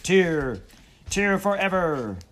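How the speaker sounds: loud, high heroic battle speech; defiant rallying cry. Acted. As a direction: rouse the crew to die bravely.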